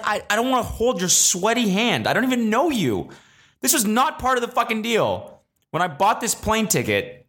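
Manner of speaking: high-pitched comic protest; indignant rant. Acted. Passenger refusing to comfort a stranger, high indignant rant. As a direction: whine in mock outrage, settling toward the end.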